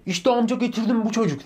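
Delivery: put-on high, loud kid's voice announcing; mock schoolboy proudly handing over his friend; proud little kid. Classmate proudly presenting the boy to the soldier, high loud kid voice.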